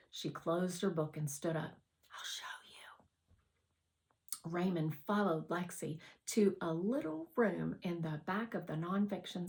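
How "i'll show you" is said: slow, much softer whisper; hushed. Acted. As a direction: breathe it conspiratorially. Sneaking into the library room.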